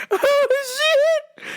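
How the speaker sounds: high delighted gasp; excited squeal. Retold or imitated. Cheering the coworker on in a high delighted squeal. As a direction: squeal it with glee.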